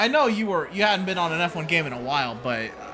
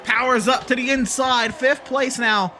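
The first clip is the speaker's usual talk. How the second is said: motorsport commentator; 6 semitones higher, slower than usual. Acted. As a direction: build up the crash call live. Live race play-by-play.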